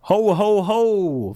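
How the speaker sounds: booming Santa Claus ho ho ho; big jolly Santa laugh. Pretend. Christmas greeting, Santa laugh.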